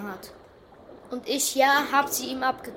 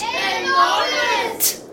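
dramatic slow announcement; 10 semitones higher, much slower than usual. Acted.

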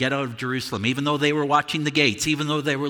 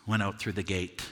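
ordinary delivery

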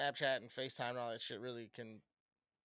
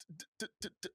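stuttered t sounds, a little higher and softer; mock stutter; 4 semitones higher, 7 dB quieter, much faster than usual. Imitating his date's stutter to his podcast co-host, mock stutter. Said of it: "She's got a full-blown stutter".

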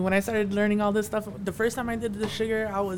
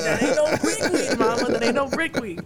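louder, higher laughing taunt; louder laughing tease of his mom; 5 semitones higher, 8 dB louder than usual. Retold or imitated. Laughing teasing his mom again.

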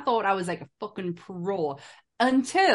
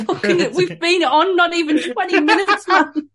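laughing; 8 semitones higher, 7 dB louder than usual. Real, not acted. Laughing complaint to a crying host.

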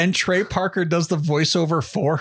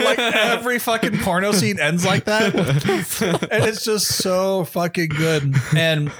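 ordinary talk, nothing marked

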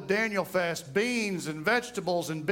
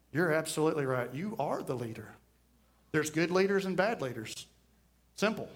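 lower, softer, unhurried; calmly agree, then turn it; calm reply; 6 semitones lower, 4 dB quieter than usual. Retold or imitated. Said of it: "I just calmly sit there and say".

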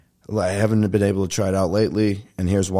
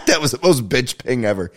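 laughing; 5 semitones higher than usual. Real, not acted. Laughs at his own voice crack.

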